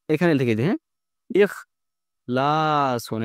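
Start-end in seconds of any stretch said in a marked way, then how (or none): 1.4-3.2 ইখলাসুন drawn out very slowly, syllable by syllable; teacher stretching ইখলাসুন out slowly; slow demo pronunciation. Shown as a demonstration. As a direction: spell out ইখলাসুন slowly for the class. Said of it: "এই যে ইখলাসুন".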